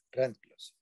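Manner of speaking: usual speaking voice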